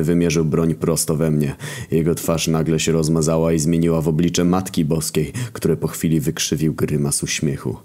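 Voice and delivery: plain reading voice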